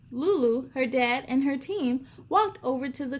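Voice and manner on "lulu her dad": plain reading voice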